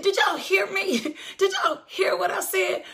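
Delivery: high, incredulous appeal to the crowd. Real, not acted. Preacher asks the congregation if they heard the diagnosis, incredulous.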